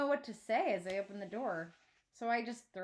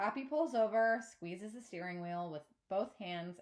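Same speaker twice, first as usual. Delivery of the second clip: ordinary delivery, nothing marked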